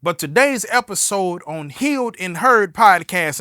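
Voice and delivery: ordinary talk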